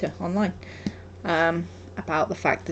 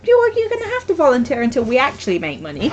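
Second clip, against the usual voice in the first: louder, higher, laying down the rule; firm and loud; 5 semitones higher, 6 dB louder than usual. Real, not acted. Crafter telling her friend she'll work unpaid, firm and loud. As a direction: say it loud and higher so there's no arguing.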